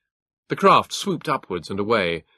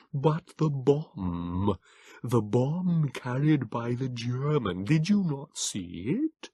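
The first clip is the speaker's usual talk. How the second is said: slow, high, thin creature voice; frail Morlock begging him into shelter; pleading Morlock; 4 semitones higher, much slower than usual. A narrator's reading. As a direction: plead gently but urgently. Morlock figure.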